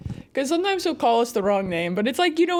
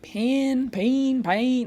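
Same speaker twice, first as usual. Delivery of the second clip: drawled Southern attempts at the name, vowel sliding; coach's accent; 2 semitones higher, much slower than usual. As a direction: try the name several ways in a Southern accent. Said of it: "'cause of his accent".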